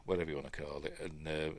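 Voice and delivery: ordinary delivery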